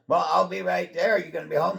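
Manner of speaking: eager ex-fiance. Retold or imitated. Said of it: "quoting her on the phone".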